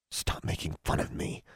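soft, breathless protest; whispered hurt plea. A narrator's reading. Girl begging the hag to stop, whispered. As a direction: plead quietly.

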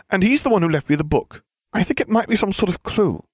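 high, know-it-all schoolgirl voice; prim Hermione. Acted. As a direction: lecture him like the cleverest witch in class. Hermione stiffly.

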